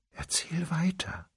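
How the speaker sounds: a soft whispered push to go on; whisper, keep going; whispered urging. Acted. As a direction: breathe it, barely voiced. She urges him on.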